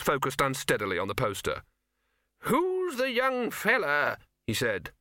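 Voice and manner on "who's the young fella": put-on high, quavering old wizard; creaky doddering old man, high pitched. Poons voice.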